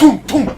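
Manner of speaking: high, loud "tonk" knocks; two punchy pecking noises; imitated double peck. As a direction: hammer out a beak strike. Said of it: "and just went".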